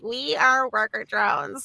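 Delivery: a hint of the Uzi voice, slow, American accent; lightly voiced deadpan robot girl; Uzi's opening line. Actress recalls her character's first line in an American accent. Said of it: "it opens with her going like".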